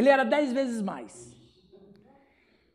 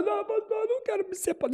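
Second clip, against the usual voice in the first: higher, softer mock pleading; imitating tearful wife; 6 semitones higher, 7 dB quieter, faster than usual. Crying wife begs the pastor not to separate, mock tearful. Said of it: "e ela chorou".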